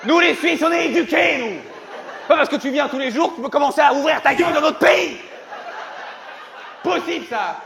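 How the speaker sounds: high pitched furious rant; angry Swiss local. Acted. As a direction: shout it like an outraged patriot.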